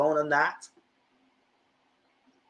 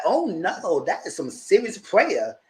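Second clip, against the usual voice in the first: mock shock; 8 semitones higher, much slower than usual. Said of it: "I said".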